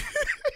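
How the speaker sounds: high burst of laughter. Real, not acted. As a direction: cracks up at his own joke. Laughs at own joke.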